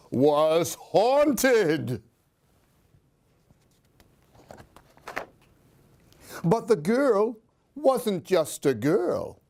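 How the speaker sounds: put-on lively read-aloud for a child; expressive children's story reading; storybook narration. A narrator's reading. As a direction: tell it like a bedtime picture book. Reads picture book aloud.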